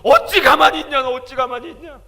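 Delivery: loud, high, anguished shout; shouted divine rebuke; Jesus rebuking. Retold or imitated. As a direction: cry out as grieving Jesus. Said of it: "저한테 그러는 거예요".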